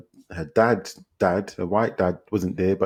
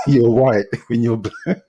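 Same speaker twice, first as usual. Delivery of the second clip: breaking into real laughter on the words; slower, louder, laughing through the line; 3 semitones higher, 4 dB louder than usual. Real, not acted. Telling a friend about the documentary's absurdity, laughing.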